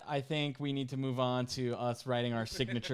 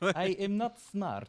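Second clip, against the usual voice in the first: mock Chekhov; 8 semitones higher, 4 dB louder, slower than usual. Joining the Chekhov bit.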